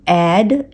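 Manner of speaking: ऐड as one syllable; plain short "ad" for ऐड; single D. Shown as a demonstration. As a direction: say ऐड with one final D. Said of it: "नहीं पढ़ते".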